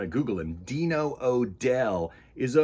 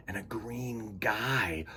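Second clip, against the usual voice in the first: slow hushed whisper; suspenseful whisper; 7 dB quieter, slower than usual. Acted. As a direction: breathe it secretly.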